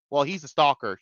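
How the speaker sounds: ordinary talk, nothing marked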